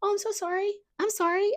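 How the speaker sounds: high, eager apologetic voice; over-apologizing. Retold or imitated. Imitating herself saying sorry a hundred times a day, high. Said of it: "practice not saying".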